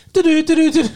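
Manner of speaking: sung news theme jingle; loud high news fanfare. Retold or imitated. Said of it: "world news is gonna pick up".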